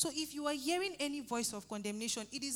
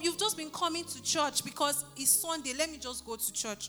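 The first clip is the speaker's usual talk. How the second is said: loud, raised-pitch fervent sermon over music; preaching at full force; 4 semitones higher, 6 dB louder than usual. Acted. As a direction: drive the congregation with passion.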